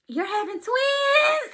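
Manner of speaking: high, loud, stretched-out shout; acted excited announcement. Doctor revealing twins to expectant mother, excited high shout. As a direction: imitate a big joyful reveal.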